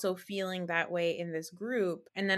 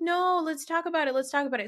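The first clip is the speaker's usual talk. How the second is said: high, pushy mock insistence; 7 semitones higher, 3 dB louder, faster than usual. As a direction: parrot their pushiness, pitched up. Mocking the women's insistence.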